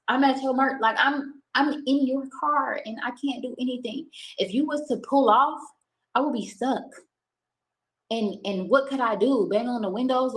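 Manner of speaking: high, scared voice; panicked. Real, not acted. Recalling being trapped in car.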